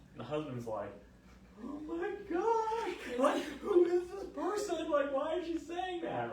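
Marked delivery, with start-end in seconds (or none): 1.6-6.3 high, slow, loud mimicked disbelief; aghast husband. Husband hears what wife said, high aghast disbelief. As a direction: exclaim in drawn-out, high-pitched dismay.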